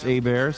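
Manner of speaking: "Hebert" said AY-bear, silent H and T; French-style pronunciation. Shown as a demonstration. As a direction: say "Hebert" as AY-bear, dropping the first and last consonants. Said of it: "It's pronounced".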